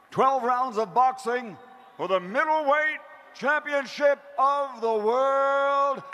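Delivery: booming ring announcer call; lucha libre announcer. Acted. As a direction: sell every word to the arena. Ring announcer opens the weigh-in.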